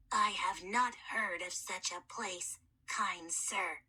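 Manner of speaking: a dusty whisper of a voice; puzzled mummy asking a stranger; whispery hoarse mummy. Acted. Mummy voice held.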